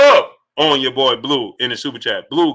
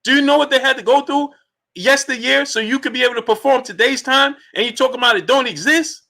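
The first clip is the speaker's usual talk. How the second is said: pitched-up, fired-up questioning; higher, indignant challenge to the chat; impassioned rant; 8 semitones higher than usual. Real, not acted. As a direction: hammer each question home, voice riding high. Indignant lecture to a dissenter.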